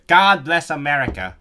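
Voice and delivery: loud, hammed-up American accent; playful over-the-top American patriot accent; mock American accent. I can do part of American accent.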